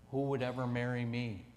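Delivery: usual speaking voice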